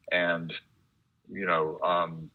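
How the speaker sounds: ordinary talk, nothing marked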